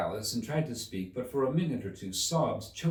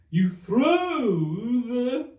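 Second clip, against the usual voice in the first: high, loud, mournful Mock Turtle; 13 semitones higher, 8 dB louder, much slower than usual. A narrator's reading. The Mock Turtle quizzes Alice, high and mournful. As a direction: stretch it out like a weepy old turtle.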